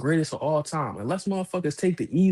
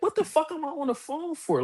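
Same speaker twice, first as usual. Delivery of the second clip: much higher incredulous whine; mock exasperation; 13 semitones higher than usual. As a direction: sound fed up and baffled. Kid's inner annoyed thought.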